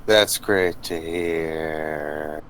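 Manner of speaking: very slow, drawn-out awkward pleasantries; weird small talk. Acted. Hannibal greeting Aelin and Daniela, weird drawn-out politeness. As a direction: stretch the words out, weirdly stilted. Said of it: "notice him being weird".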